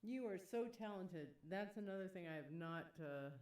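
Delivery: ordinary delivery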